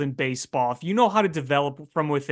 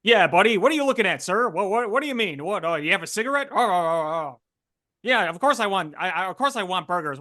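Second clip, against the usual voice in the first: put-on higher, pushy old-movie Transatlantic tough-guy accent; 1950s Transatlantic accent; 5 semitones higher, faster than usual. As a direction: play a 1950s Transatlantic-accent spy who insists he isn't one, fast and defensive. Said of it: "And he talked, like, in, like, nineteen fifties Atlantic, uh, accent".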